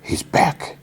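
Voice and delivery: breathy, voiceless imitation of Fisk; deep-breathed quote. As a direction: say it on a heavy breath, acting him out. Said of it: "breathes deeply".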